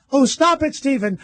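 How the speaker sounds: high, theatrical coy reply; hammy stage actress. Retold or imitated. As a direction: overplay the coy leading lady. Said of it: "They go".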